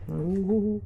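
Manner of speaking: high ooh at the heat; hot food ooh. Real, not acted. Tasting hot chili.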